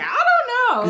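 very high, loud comic uncertainty; bewildered doubt. Retold or imitated. Student telling another mentor about the offer, bewildered doubt. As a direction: wail it lightly in mock helplessness.

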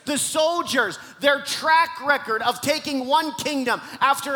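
high, loud sermon delivery; full-force preaching. Acted. Preacher rousing the church with Barak's story, full-force preaching. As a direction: preach it big, stretching the key words.

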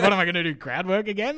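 mock panic with a laugh; laughing despair. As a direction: laugh at your own desperation. Comic despairing on stage.

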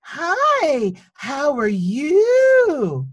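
high, slow, overly sweet sing-song greeting; patronizing teacher voice. Retold or imitated. Teacher greeting a four-year-old on the first day, high and slow. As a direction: talk down to a small child, syrupy and drawn out. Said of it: "the teacher leans down and she goes".

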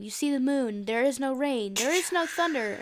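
ordinary talk, nothing marked

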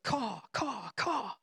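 quiet imitated raven call; crow caws. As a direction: caw it out like a frightened crow. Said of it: "a single syllable call like a raven or a crow".

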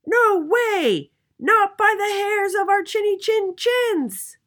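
very high, loud piglet shriek; shrill squealing pigs; little pigs crying out. A narrator's reading. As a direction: squeal a defiant refusal at full voice. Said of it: "cried the little pigs".

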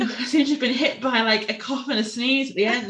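giggly after a sneeze; laughing. Real, not acted.

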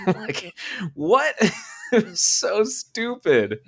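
high, laughing what, then slow it was so stupid; cracking up at how dumb it is; incredulous laughter; laughing disbelief. Real, not acted.

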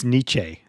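Nietzsche said "NEE-chay"; niche as "neechay". Shown as a demonstration. Correcting co-hosts in jest, Nietzsche said "neechay". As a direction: joke pronunciation ending in a long ay. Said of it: "I think it's Nietzsche".

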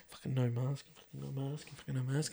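barely audible mutter meant not to be heard; muttered under breath. Retold or imitated. Worker grumbling under his breath about no mask, muttering. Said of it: "muttering under his breath".

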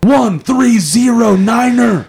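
high, slow shouted radio call. Acted. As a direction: bark it over the radio, slowly. Radio call exchange acted.

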